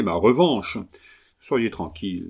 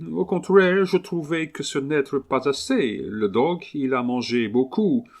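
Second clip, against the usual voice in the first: higher-pitched Englishman speaking broken French; English accent; 4 semitones higher than usual. Acted. Englishman haggling over his new dog, English accent. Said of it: "l'accent anglais".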